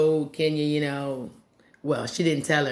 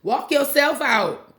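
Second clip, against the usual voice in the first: sassy comeback; 10 semitones higher, slower than usual. Pretend. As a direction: snap it back like she's lost her mind. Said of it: "I was like".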